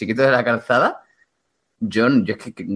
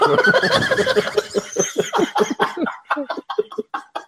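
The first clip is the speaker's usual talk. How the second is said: wheezing laugh. Real, not acted. Cracking up at joke.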